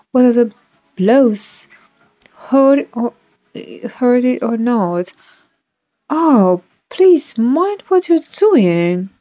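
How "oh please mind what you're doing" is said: high, frantic plea; terrified cry. A narrator's reading. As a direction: cry out in panic. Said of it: "cried Alice ... agony of terror".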